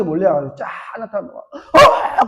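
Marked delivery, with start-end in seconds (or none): 1.4-2.3 sharp, much higher startled cry; jolted exclamation; surprised gasp. Retold or imitated. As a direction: parents shocked to see their son. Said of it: "그러면서 막, 놀래가지고".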